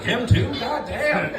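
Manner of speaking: mock shock. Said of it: "I'm like".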